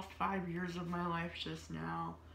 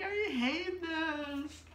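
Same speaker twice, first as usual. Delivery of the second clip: mock baby whine; 10 semitones higher, 3 dB louder, much slower than usual. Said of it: "crying and sobbing".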